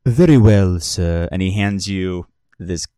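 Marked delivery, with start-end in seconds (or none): 0.1-1.3 louder, formal server voice; crisp and attentive; courteous bartender. Acted. Bartender taking the bottle order, crisp and formal.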